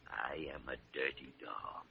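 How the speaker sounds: whispered guilty admission; mock confession. Doctor admits to nurse he's a dirty dog, whispered. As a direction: breathe it out like a sheepish confession.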